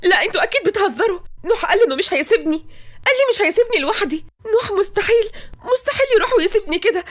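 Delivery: very high, fast tearful denial; crying breakdown. A narrator's reading. Bride denying the groom is dead, hysterical crying. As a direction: fall apart sobbing, refusing to believe. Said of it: "تقى ببكاء وانهيار".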